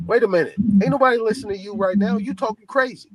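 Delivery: high, rising, fast scolding; mock callout to a rambling woman; retold telling-off. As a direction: snap back at her, pitch climbing.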